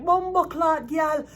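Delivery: high, loud Jamaican patois outburst; imitating a furious Jamaican boyfriend in patois; Jamaican man cussing. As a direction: cuss her out like an outraged Jamaican man. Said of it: "A Jamaican man would be like".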